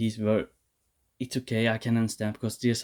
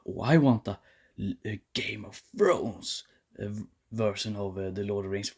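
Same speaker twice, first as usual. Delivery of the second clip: mocking Bezos quote; 4 semitones lower, 5 dB quieter than usual. Ranter parodying Jeff Bezos wanting Game of Thrones, soft sneering mock. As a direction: say it as a dismissive little parody. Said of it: "who like".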